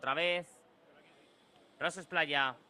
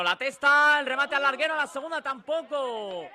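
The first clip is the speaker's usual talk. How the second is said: high, loud near-miss cry; near-miss shout; 9 semitones higher, 8 dB louder than usual. Real, not acted. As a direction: yell as the ball hits the bar. Header hits the crossbar.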